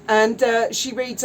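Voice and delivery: normal talking voice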